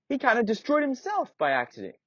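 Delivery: put-on high, wide-eyed kid; a little boy impressed; Timothy reacts to the blacksmith's name; Timothy amazed. Timothy speaks.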